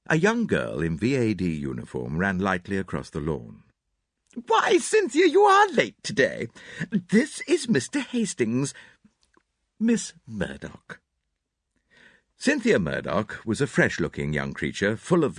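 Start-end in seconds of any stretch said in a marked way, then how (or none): 4.5-11.0 put-on high, fluttery elderly lady; gushing hostess, a little theatrical; Mrs Inglethorpe. A narrator's reading.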